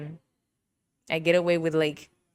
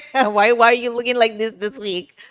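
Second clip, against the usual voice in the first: higher, louder, through a laugh; laughing tease; 5 semitones higher, 6 dB louder than usual. Real, not acted. Teasing the boss about his jeans, laughing. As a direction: crack up while roasting.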